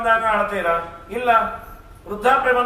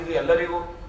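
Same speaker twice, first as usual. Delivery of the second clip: usual speaking voice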